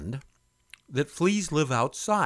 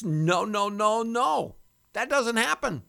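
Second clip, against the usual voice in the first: playful high no; 9 semitones higher, slower than usual. Real, not acted. Playful denial.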